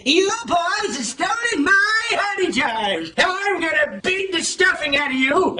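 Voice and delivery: high, slow angry scolding; Rabbit. Acted. Rabbit confronts the honey thieves, angrily. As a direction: scold them in drawn-out indignation. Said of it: "Rabbit was very angry and said".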